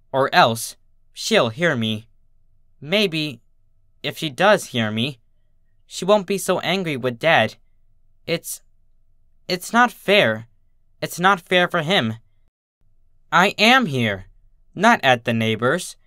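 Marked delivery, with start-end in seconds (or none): none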